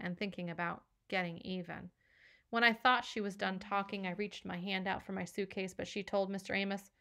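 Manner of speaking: ordinary delivery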